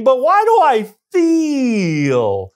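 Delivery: "feel" high and hugely drawn out; exasperated stress on feel. Acted. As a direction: stretch "feel" into a long falling wail. Acts out his own nagging question.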